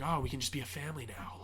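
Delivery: creepy whispered imitation; soft, eerie retold whisper; super weird. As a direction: whisper it like an unsettling would-be father. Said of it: "just being super weird".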